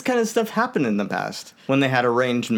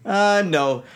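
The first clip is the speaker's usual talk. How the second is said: usual speaking voice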